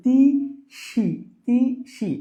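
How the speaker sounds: তিসি drawn out slowly as two separate syllables; broken into syllables. Shown as a demonstration. Teacher splitting a word for exam students, slow syllable-by-syllable তিসি. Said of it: "তিসি শব্দটাকে ভাঙি".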